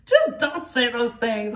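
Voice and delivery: high, laughing through the words; nervous laugh. Real, not acted. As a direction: giggle nervously while scolding. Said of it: "That's my nervous laugh".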